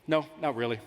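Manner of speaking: low, soft and casual; offhand reply. Retold or imitated. Driving boyfriend answering his girlfriend, low offhand reply. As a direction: toss it off quietly, unconcerned. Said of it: "I look over and I go".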